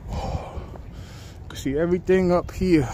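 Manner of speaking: winded and soft; catching breath after the climb; running out of air. Real, not acted. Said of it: "I'm running out of breath".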